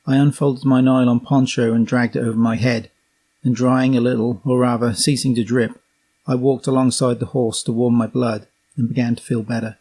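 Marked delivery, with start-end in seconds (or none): none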